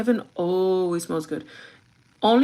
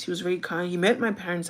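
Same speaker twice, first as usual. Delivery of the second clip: ordinary talk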